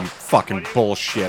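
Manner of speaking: usual speaking voice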